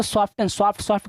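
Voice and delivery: सॉफ्टन and सॉफ्ट with the T sounded; common mispronunciation. Retold or imitated. Teacher quoting how some people say it, mispronounced. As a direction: put a T after the F in सॉफ्टन, सॉफ्ट. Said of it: "कुछ लोग इसको".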